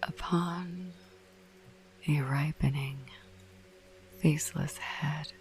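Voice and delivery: slow, spooky whispered verse reading; hushed, eerie poetry reading; whispered poem recital. Acted. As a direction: savour each line like a ghost story.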